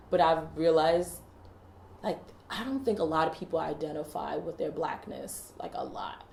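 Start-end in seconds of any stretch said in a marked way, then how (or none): 5.6-6.3 whispered, unvoiced; whispered emphasis on a lot; whispered aside. Real, not acted. Aside under her breath.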